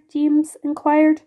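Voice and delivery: ordinary delivery, nothing marked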